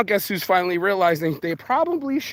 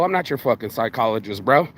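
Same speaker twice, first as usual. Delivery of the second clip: ordinary delivery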